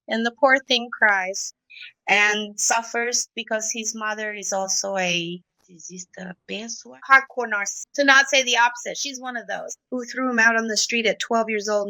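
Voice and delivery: ordinary talk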